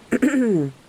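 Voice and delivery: a polite little throat clear. A narrator's reading. Ranger politely getting the strange woman's attention, throat clear. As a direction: clear your throat to get her attention. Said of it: "I cleared my throat as I addressed her".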